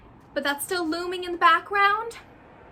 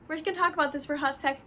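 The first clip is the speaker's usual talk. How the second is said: ordinary talk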